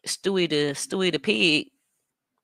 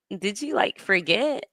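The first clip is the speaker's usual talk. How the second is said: ordinary delivery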